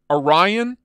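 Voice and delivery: "Orion" as uh-RYE-un, stressed RYE; trial pronunciation. Shown as a demonstration. Streamer tests another way to say it, "Orion" as uh-RYE-un. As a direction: say "Orion" as uh-RYE-un, rising at the end. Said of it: "how the fuck do you say it".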